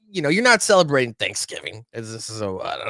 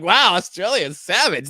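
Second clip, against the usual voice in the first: mock amazement; 6 semitones higher, 6 dB louder than usual. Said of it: "And I'm just like".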